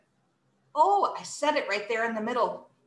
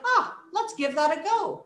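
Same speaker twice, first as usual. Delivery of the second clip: higher, light casual suggestion; easygoing encouragement; 7 semitones higher than usual. Acted. Teacher inviting students to try, light and encouraging. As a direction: lift it, happy to have a try. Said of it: "making it sound like".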